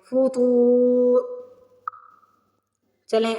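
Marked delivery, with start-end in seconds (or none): 0.0-1.2 فطور with a heavy to stretched long, very slow; slow tajweed recitation. Shown as a demonstration. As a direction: draw out the to of فطور. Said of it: "پھر را کی آواز آنی ہے".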